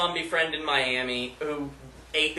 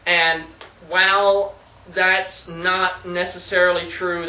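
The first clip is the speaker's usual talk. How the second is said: ordinary talk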